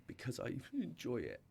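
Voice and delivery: a teary laugh, then a small, soft, shaky answer; half laughing, half crying, recalling the moment; laughing through tears. Retold or imitated. To his mentor by the pool, a soft teary laugh. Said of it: "And through the tears".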